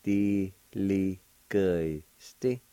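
"Diligesti" stretched out syllable by syllable; extra slow teaching demo; very slow pronunciation. Shown as a demonstration. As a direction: draw the word out very slowly. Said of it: "It'll be pronounced like this".

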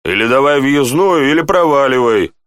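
impatient guard's demand; rude. A narrator's reading. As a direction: send him packing rudely. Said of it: "Страж разговаривал грубо".

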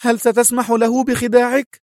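high, indignant woman's voice; high-voiced outrage; angry cry. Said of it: "صاحت سونيا في غضب".

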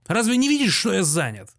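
high-pitched irritated question; brusque scolding. A narrator's reading. Postmaster snaps at a woman at the counter, irritated. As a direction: scold a pestering customer. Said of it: "крикнул он бабе".

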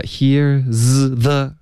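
HERE'S THE split into here, z, the; slow, broken-up "here's the"; three-sound mistake. Shown as a demonstration. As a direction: demonstrate a detached z in "here's the". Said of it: "这三个音不对".

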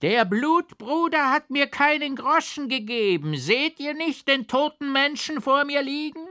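shrill, very high loud crone shriek; shrieking old hag. A narrator's reading. Mad beggar woman screeches at the rider, crone shriek. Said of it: "Aber die Alte kreischte".

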